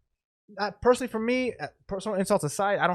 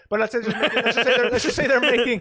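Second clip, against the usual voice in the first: higher, louder, through laughter; laughing; 6 semitones higher, 7 dB louder than usual. Real, not acted. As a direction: laugh while trying to restart the sentence. Cracks up restarting his example.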